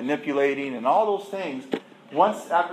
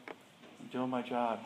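slow, quiet repeat for emphasis; 4 semitones lower, 11 dB quieter, much slower than usual. Shown as a demonstration. Speaker to the room, slowly and softly repeating. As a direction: drag out the words slowly and softly.

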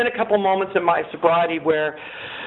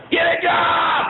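mock heckling shout; 11 semitones higher, much slower than usual. Pitiless younger self at panhandlers, heckling yell.

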